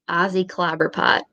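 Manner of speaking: normal talking voice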